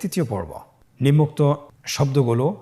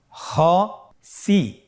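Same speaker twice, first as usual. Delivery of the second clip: "খসি" very slowly in two separate syllables; syllables apart; 3 semitones higher, much slower than usual. Shown as a demonstration. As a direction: split "খসি" with a clear pause between syllables. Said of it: "এভাবেও পড়তে পারেন". ("খসি" ভেঙে ভেঙে ধীরে)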